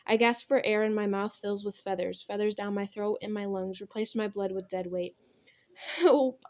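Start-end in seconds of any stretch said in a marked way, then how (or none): 5.7-6.5 high, loud cry for help; sobbing scream. A narrator's reading. Girl choking on feathers, screaming for help. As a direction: cry out in panic, pitched up. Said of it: "I sob and scream".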